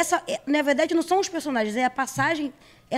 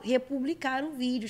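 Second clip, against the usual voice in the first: usual speaking voice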